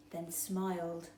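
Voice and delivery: ordinary delivery, nothing marked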